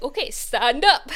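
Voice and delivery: loud, drawn-out mock order; retold teacherly command, punched loud. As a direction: order a pupil up, playfully forceful.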